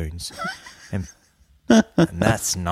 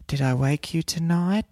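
woman caller; 9 semitones higher than usual. Retold or imitated. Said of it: "And she goes".

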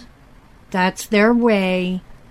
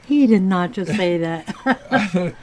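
shocked laughter. Real, not acted. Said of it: "Oh, my God".

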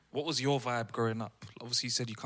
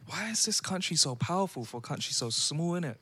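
higher, louder incredulous ask; baffled question; 6 semitones higher, 4 dB louder than usual. Retold or imitated. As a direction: voice their own disbelief. Recalling their first reaction.

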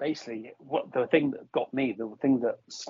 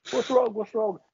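much higher, fretful "what's wrong"; high worried voice; 11 semitones higher than usual. Retold or imitated. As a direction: squeak it out like a fussing pet. Mimicking anxious pacing friend.

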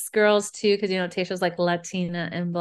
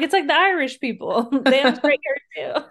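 excited retort; 7 semitones higher, 7 dB louder than usual. Retold or imitated. Retold argument about Irish hair.

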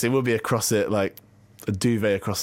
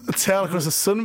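higher-pitched attempt at Aussie accent; Australian accent; 7 semitones higher than usual. Host copying co-host's Aussie line, shaky Australian accent. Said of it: "I can't do the accent".

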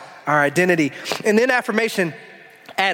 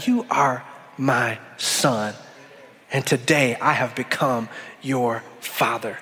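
soft, slow and lower, like a father to his son; hushed fatherly blessing; tender voice of God; 6 semitones lower, 4 dB quieter, slower than usual. Acted. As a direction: lean in and say it gently, slowly. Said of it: "Hear God speaking to you".

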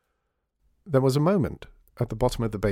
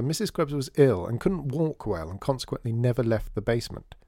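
usual speaking voice

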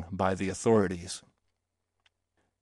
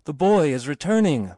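raised voice relaying a call; repeated cry; 5 semitones higher, 4 dB louder than usual. A narrator's reading. Other voices repeating the news, cried out. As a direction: pass the cry along, higher-pitched. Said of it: "who repeated".

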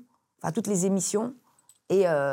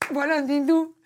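ordinary delivery

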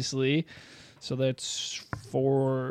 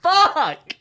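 high, explosive yell; real shouted curse; 13 dB louder than usual. Real, not acted. Player curses at a natural twenty against them, shouted high. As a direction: burst out loudly at bad news.

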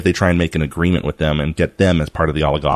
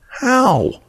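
mock disbelief. Mock disbelief at BuzzFeed value.

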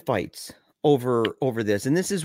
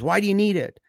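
higher, insistent retold demand; retold heated demand; 8 semitones higher, 3 dB louder, faster than usual. Andy pressing Michael over the toy safe, mock heated. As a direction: push the question like a mild mock argument. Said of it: "they're yelling at each other".

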